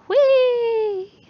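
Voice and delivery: high, loud, gleeful squeal; long, soaring whoop; Galen whooping. Acted. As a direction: cry out as if flying down a slide. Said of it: "might have heard Galen going".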